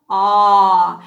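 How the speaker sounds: "ah" loud, as the short o of ostrich; short o sound. Shown as a demonstration. Teacher sounding out the letter O to the class, loud ah. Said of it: "It makes the short sound".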